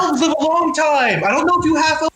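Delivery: very high comic panic; high-pitched, hammed-up freak-out; panicked. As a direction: wail it high in alarm. Panicking client protests to seer.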